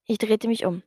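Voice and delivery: plain reading voice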